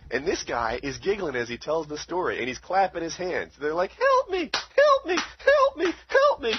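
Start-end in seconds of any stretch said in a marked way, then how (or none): 3.9-6.5 piercingly high, loud mock pleading; mimicked screams for help. Retelling a guard's story of a trapped prisoner, hammed-up screaming. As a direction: squeal it in an exaggerated falsetto, over and over. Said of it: "hears him screaming for help".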